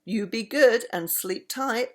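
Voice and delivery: ordinary delivery, nothing marked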